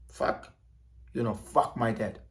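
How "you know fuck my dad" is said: ordinary talk, nothing marked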